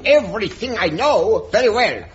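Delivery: hammed-up German accent, lightly done; ridiculous German accent. Human cannonball answering Captain Horn, mock German accent. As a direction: play a comic German strongman with a mild German accent. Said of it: "drop this ridiculous accent".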